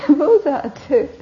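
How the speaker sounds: laughing through the line; tickled by the story, real laugh; giggling retelling. Real, not acted. Speaker laughs at own story.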